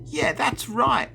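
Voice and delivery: very high, slow creature voice; little monster screech. A narrator's reading. Little monster backing the others, high screech. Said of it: "a little one screeched".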